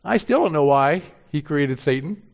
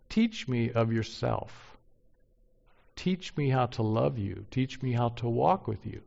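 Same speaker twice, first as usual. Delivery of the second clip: humble prayer. Shown as a demonstration. Pastor models a humble prayer.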